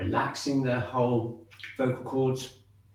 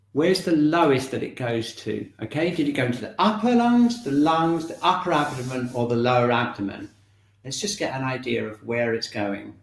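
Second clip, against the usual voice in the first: ordinary talk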